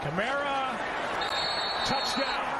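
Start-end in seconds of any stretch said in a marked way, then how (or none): none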